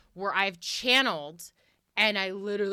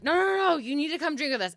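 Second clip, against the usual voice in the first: higher, pushy party-ghost voice; insistent spirits; 7 semitones higher, much faster than usual. Retold or imitated. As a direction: coax her like rowdy drinking ghosts. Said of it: "And they're like".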